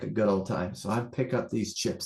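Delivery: ordinary talk, nothing marked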